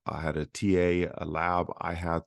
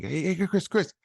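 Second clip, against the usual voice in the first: spunky, high-pitched patter; high, peppy impression of a fast talker; John; 8 semitones higher than usual. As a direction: imitate an eager mentor's rapid-fire pitch. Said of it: "He's real spunky, fast talking".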